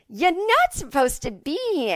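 mock indignant telling-off; mom's scolding. Mom confronting a young man on the golf course, scolding. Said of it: "my mom's like me".

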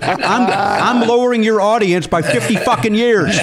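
hammed-up offended, pitch up, laughing; mock outrage at the guest's joke about the show. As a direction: pretend to be insulted. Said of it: "How dare you".

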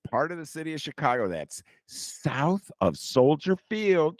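slow, deliberate, spelling out a shocking fact; slow mock revelation. [name] teasing North Siders about the South Side, slow mock revelation. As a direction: drag it out like breaking big news.

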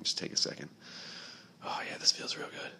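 hushed, mock-intimate whisper; creepy whisper. Host pretending to hug the listener, creepy whisper.

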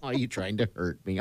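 usual speaking voice

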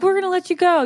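bow out politely in a high voice; polite sign-off. Acted. Guests ending a two-minute chat, high polite sign-off.

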